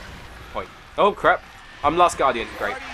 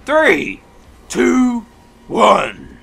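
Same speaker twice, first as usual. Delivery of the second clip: louder higher count to go; loud countdown; 8 semitones higher, 10 dB louder, much slower than usual. Real, not acted. Gamer counting down the attack, loud. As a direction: count them in for the charge.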